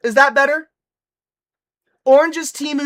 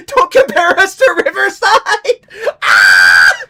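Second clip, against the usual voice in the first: laughing outrage; 5 semitones higher, 4 dB louder, slower than usual. Real, not acted. Mock outrage at chat.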